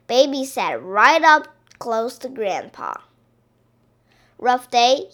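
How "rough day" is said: ordinary delivery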